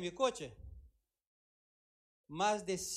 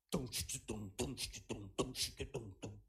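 low, soft drumming tum; deep soft thumps; hammering sound; 11 semitones lower, 7 dB quieter, slower than usual. Retold or imitated. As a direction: imitate pounding a stake. Imitating hammering a stake.

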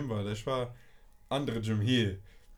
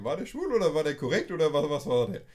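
ordinary delivery

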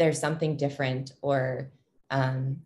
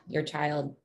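normal talking voice

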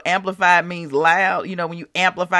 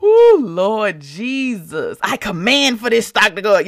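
mock prophesying; 3 dB louder than usual. Prophesying to the stock, hammed-up commanding prayer. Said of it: "I'm trying to prophesy to the stock".

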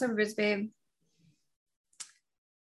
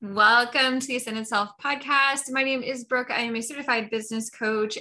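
upbeat, higher announcer read; podcast host intro; 3 semitones higher, 6 dB louder than usual. Acted. Host opening the podcast for listeners, bright announcer read. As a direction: welcome listeners like a radio host.

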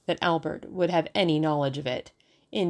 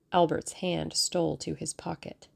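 ordinary delivery, nothing marked

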